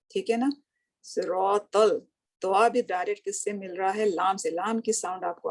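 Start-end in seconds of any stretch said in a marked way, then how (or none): 1.0-2.0 सिरातल said very slowly with a clear लाम; teacher showing the लाम sound in सिरातल; slow recitation. Shown as a demonstration. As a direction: stretch सिरातल so the लाम is heard. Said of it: "अगर हम उसको अलग से पढ़ें".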